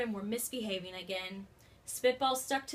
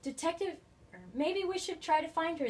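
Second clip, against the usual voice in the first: put-on eager kids; 6 semitones higher than usual. A narrator's reading. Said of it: "they said".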